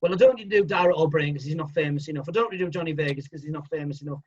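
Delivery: rattled off fast; fast, breezy self-justification; quick dismissive reasoning. Retold or imitated. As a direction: hurry through the excuses offhandedly. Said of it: "said to the producer".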